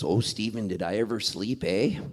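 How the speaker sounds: low, soft Cape Breton accent impression. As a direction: imitate a mom with a Cape Breton lilt. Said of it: "my mom's from Cape Breton, has a thick accent".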